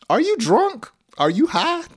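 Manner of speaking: very high-pitched playful disbelief; mock incredulous. Teacher acting out questioning someone's behavior, mock incredulous. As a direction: fire off astonished mock questions.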